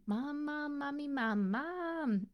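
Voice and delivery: put-on a child pestering over and over; kids calling mom. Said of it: "Lori's hearing".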